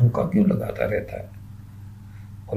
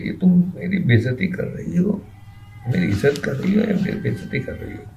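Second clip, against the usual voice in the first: much higher, offended questioning; indignant outrage; 10 semitones higher than usual. Acted. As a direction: demand to know if she insults him. Fulwa indignant at insult.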